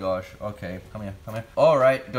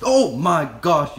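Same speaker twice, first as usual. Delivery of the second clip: startled; 6 semitones higher, 9 dB louder, slower than usual. Real, not acted. Spider startles handler, "I just jumped.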